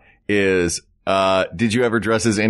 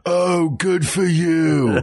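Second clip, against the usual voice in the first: mocking voice; 7 semitones higher, much slower than usual. Mocking the lighting guy.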